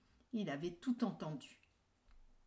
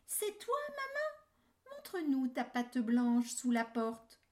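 put-on high, louder doubtful kids; little kids' voice; 8 semitones higher, 7 dB louder than usual. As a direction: ask like wary little goats. Kids question wolf.

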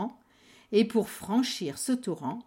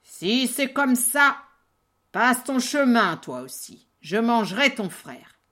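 troll voice; 3 semitones higher, 6 dB louder than usual. Acted. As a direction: grumble like a troll. Troll lets second goat pass.

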